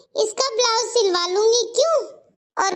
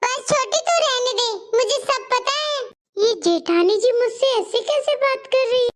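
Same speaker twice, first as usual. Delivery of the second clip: ordinary delivery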